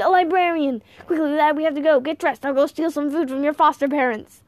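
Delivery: put-on loud, high, hurried old man; rally the boy in alarm; urgent exclamation. A narrator's reading. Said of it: "he exclaimed".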